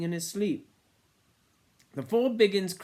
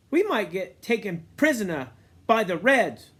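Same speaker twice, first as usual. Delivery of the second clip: put-on boy's worried voice; 7 semitones higher, 8 dB louder than usual. A narrator's reading. Boy voiced in novel reading.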